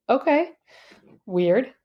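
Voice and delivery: doubtful, lifted okay and dry aside; judgy. Retold or imitated. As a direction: humor them, then judge. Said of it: "I was being so judgy".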